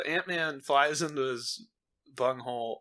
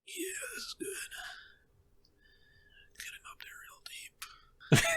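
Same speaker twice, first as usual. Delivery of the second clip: mock director whisper; 13 dB quieter than usual. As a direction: whisper it, savoring each word. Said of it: "the Russo brothers are like".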